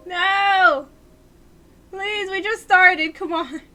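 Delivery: high, loud wail; desperate pleading. Real, not acted. Player pleading with the game just after starting, high loud plea. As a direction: beg loudly against the loss.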